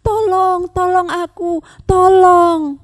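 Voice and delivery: high, desperate pleading cries; panicked cry for help. A narrator's reading. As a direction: call for help as if sinking in mud. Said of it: "dia teriak lagi". (teriakan panik minta tolong)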